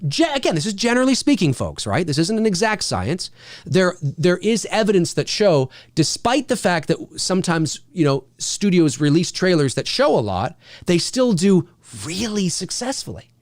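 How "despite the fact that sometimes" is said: ordinary talk, nothing marked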